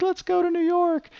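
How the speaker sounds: very high cartoon squeal; giddy child begging to go; squeaky mock glee; excited kids. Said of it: "They're like".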